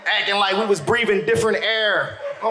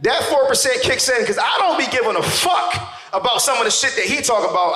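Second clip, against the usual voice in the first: ordinary delivery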